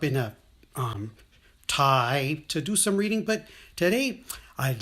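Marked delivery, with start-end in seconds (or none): none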